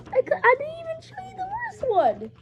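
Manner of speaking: high, fast giggling words; blurting through giggles; laughing. Real, not acted. Laughing at forgotten picture.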